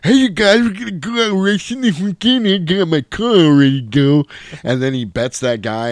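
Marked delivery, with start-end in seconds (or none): 0.0-4.2 high, loud excited weasel voice; very high, eager little racer; weaselly dude. Retold or imitated. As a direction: play a hyped little weasel. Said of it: "the dude that's like".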